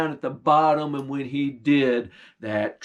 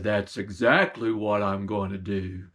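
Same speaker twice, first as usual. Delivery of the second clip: low bear voice; 7 semitones lower, 3 dB quieter than usual. Acted. Bear sniffing out the stolen meat, low and menacing. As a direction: rumble it like a hungry bear.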